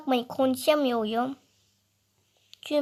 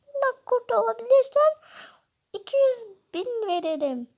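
high taunting voice; other character voice; 10 semitones higher than usual. Acted. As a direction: voice the rival, pitched high and teasing. Rival character voice.

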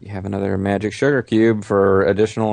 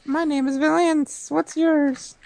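very high, slow princess voice; girly princess voice; slower than usual. Pretend. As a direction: coo it like a dainty princess. Voicing the princess.

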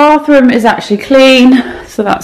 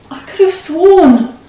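high, slow and puzzled; can't believe it wasn't run; genuinely confused, pitched way up; baffled disbelief; 9 semitones higher, slower than usual. Real, not acted. Puzzled the dishwasher is off.

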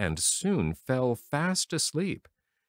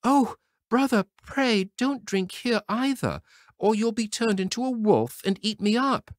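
high, loud pleading sister; frightened sister cries to her brother; crying out; 13 semitones higher, 5 dB louder than usual. A narrator's reading. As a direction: beg him not to drink, much higher. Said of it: "And she cried".

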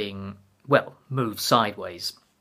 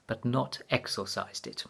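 plain reading voice

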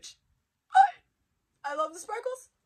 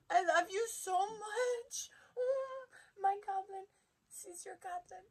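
cooing; 10 semitones higher, 3 dB quieter than usual. Real, not acted. Cooing to the figure.

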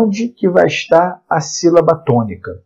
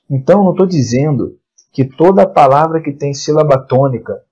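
ordinary talk

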